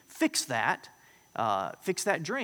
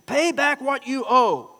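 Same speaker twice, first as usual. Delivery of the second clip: much higher and louder, slow menacing demand; angry demand; 9 semitones higher, 9 dB louder, slower than usual. Acted. Unforgiving slave choking his fellow slave demands repayment. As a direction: bark it at the debtor you're choking. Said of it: "seized him and began to choke him".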